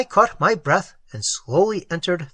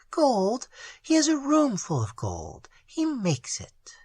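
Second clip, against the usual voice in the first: higher, voiced startled cry; high surprised exclamation; 8 semitones higher, 5 dB quieter than usual. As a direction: exclaim it in shock. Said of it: "she exclaimed".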